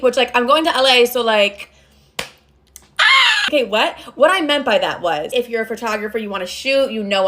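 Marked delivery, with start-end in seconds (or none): 3.0-3.4 short, very high, loud squeal; excited squeal. Real, not acted. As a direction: let out a piercing little squeal. Squeals about going to LA.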